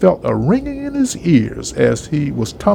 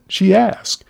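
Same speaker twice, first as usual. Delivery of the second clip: ordinary delivery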